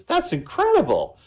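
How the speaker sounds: very high exclamation of wonder; amazed ringmaster. Acted. Ringmaster told of a talking duck, amazed. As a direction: marvel at it, pitch soaring.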